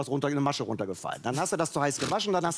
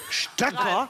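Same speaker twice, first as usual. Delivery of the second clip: incredulous; 5 semitones higher than usual. Retold or imitated. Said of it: "Anne... Stöcker".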